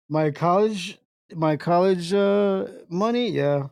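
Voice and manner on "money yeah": usual speaking voice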